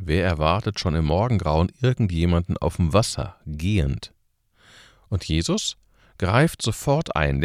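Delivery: ordinary talk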